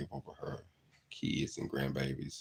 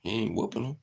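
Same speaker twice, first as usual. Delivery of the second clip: mock disbelief; 8 semitones higher than usual. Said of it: "and I'm like".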